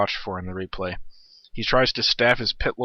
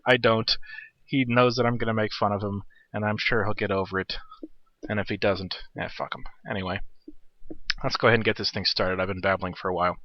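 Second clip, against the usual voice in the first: ordinary talk, nothing marked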